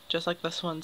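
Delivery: ordinary delivery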